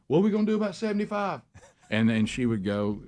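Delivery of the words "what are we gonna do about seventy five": high, rapid mock coach yell; retold coach scream. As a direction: imitate a coach yelling in a high, hurried voice. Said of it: "and they would scream".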